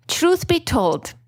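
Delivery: ordinary delivery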